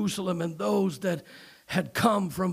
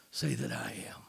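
whisper; 10 dB quieter than usual. Acted.

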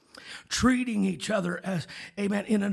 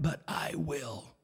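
hushed threat; 3 semitones lower, 4 dB quieter, slower than usual. Acted. God's quiet promise after a build.

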